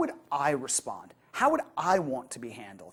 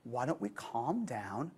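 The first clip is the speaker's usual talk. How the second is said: calming partner; 4 semitones lower, 8 dB quieter, slower than usual. Retold or imitated. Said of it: "you might as well be saying".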